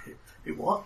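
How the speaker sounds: hushed, stammered question; whispered disbelief. Acted. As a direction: whisper back as a baffled onlooker. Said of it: "hear the whispers like".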